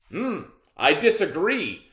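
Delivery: the man disagrees. Acted. Teacher voicing the man arguing with the lion, loud and confident. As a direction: push back on the lion. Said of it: "said the man".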